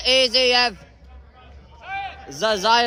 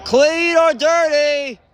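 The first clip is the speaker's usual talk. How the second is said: slow questioning call; 5 semitones higher, 5 dB louder, slower than usual. Real, not acted. Asks about a hit live.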